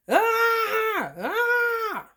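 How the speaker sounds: high, drawn-out mock wail; two long high cries, acted out; imitated child's scream. Child at the window screaming for father, mock wail.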